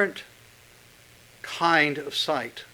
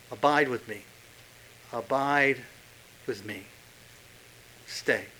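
tender plea; 4 semitones lower, 7 dB quieter than usual. Acted. Voicing Jesus' tender plea.

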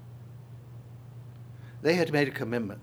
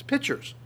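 "pitchers" leapt up high; "pitchers" said much higher; stressed "pitchers"; 12 semitones higher than usual. Shown as a demonstration. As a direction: fling "pitchers" up to show how big. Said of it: "not just a little bit".

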